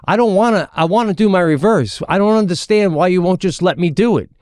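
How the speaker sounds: put-on high, pleading kid voice; earnest young daughter. Ten-year-old daughter pleading with her coach, high earnest protest. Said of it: "talking politely to this guy".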